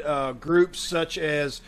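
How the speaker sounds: ordinary delivery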